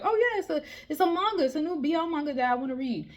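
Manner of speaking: lightly voiced gushing fan; mocking fan voice. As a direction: parody the excited posters. Mocking Facebook posters.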